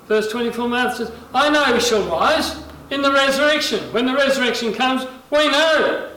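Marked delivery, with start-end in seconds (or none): 1.3-6.1 raised, higher voice pressing the point; preacher acting out an emphatic protest; insistent reply. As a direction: build to a loud, high assertion.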